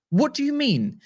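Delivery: high-pitched disbelieving challenge; incredulous. Real, not acted. Host questioning the ever-changing contract figures, incredulous. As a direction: throw it out high, in exasperated disbelief.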